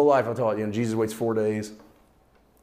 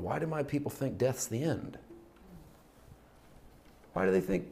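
soft, grieved voice imitating a lament; understated tearful plea; 5 semitones higher, 7 dB quieter than usual. Jesus crying over his people today, an understated tearful plea. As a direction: plead quietly, as if hurt for them. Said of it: "he's still crying over us today".

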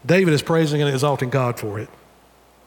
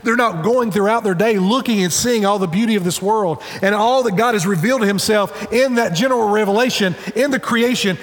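ordinary talk, nothing marked

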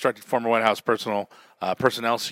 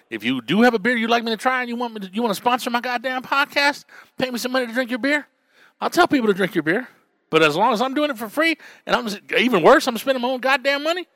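much higher, animated sponsor plea; excited pitch; 12 semitones higher than usual. Real, not acted. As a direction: hype it up, half joking.

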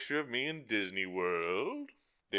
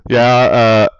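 very loud, chill bro; loud dude voice; 3 semitones higher, 25 dB louder than usual. Pretend. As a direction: boom it like a laid-back stoner. Acted dude dialogue.

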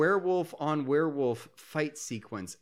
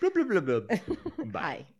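laughing; 5 semitones higher, slower than usual. Pretend. Silly subtitle riff, laughing.

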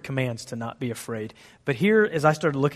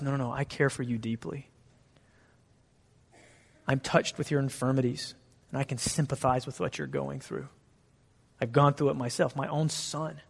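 much softer, gentle reassuring voice; tender comfort; 8 dB quieter than usual. Pretend. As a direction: speak as a caring father, hushed. Voicing God's tender heart.

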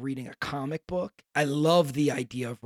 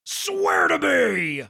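very slow, much higher, loud strained growl; hammed-up Batman rasp; 13 semitones higher, 8 dB louder, much slower than usual. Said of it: "And then the".

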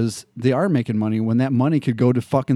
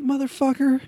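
much higher, exasperated swear; mock cop retort; 12 semitones higher, 7 dB quieter than usual. The cop answering the celebrity, high exasperated swear. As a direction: spit it out in disbelief. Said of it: "It's like".

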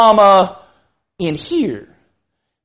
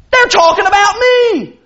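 higher-pitched touchy jumping to conclusions; lightly acted, instantly suspicious churchgoer; overly defensive person; 7 semitones higher than usual. Preacher plays a member overhearing gossip, overly defensive. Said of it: "I'm gonna be the overly defensive person".

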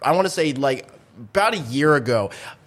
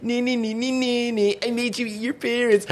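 lightly voiced mocking kid chant, higher; gloating Cartman imitation; 8 semitones higher, 3 dB quieter than usual. Friend imitating Cartman gloating to the bully, mock taunting chant. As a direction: taunt sing-song like a bratty kid. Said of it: "and he's like".

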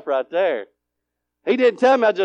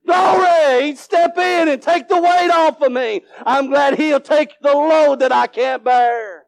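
high, loud exultant praise; full-force preaching; 7 semitones higher, 5 dB louder than usual. Real, not acted. Preacher praising God for taking his load, full-force preaching. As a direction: cry glory and preach it out high.

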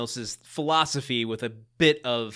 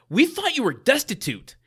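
mock retold quote; 11 semitones higher, 5 dB louder than usual. Mocking recap of a TV scene.

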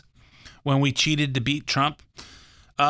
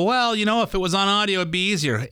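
higher mock customer voice; imitating a reader; 8 semitones higher than usual. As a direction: play the reader wishing for audio. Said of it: "They go".

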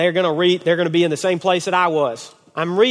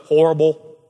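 loud, gloomy one-word answer; mock gloom; 5 dB louder than usual. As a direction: imitate a sullen teen, louder. Retold gloomy teen's usual answer.

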